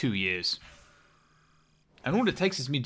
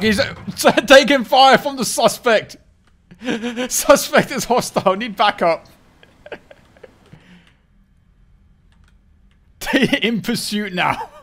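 high, loud cop-radio call through laughter; mock police radio; 9 semitones higher, 9 dB louder than usual. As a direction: report the chase like a cop on the radio.

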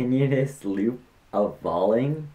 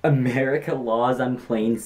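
ordinary talk, nothing marked

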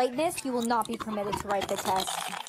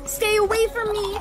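shrieking; 10 semitones higher than usual. Acted. Classmate recoils in fear.

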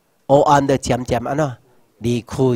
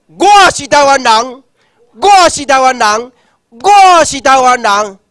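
high, slow rally shout, much louder; loud declaration; 9 semitones higher, 7 dB louder than usual. Shown as a demonstration. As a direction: shout it three times with pride. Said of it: "你大声讲".

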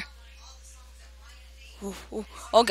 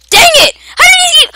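much higher, louder outburst of rage; real frustrated shout; 11 dB louder than usual. Real, not acted. Gamer just eaten by another player, real angry shout. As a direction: yell at the game in frustration. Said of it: "I'm not gonna rage quit".

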